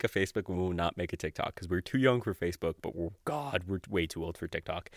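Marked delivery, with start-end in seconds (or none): none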